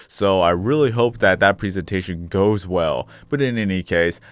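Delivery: normal talking voice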